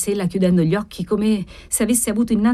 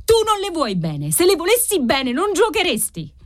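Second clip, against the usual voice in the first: desperate accusation; 9 semitones higher than usual. A narrator's reading. Wife accuses gambling husband over their daughter, desperate. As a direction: throw it back at him, pitch high.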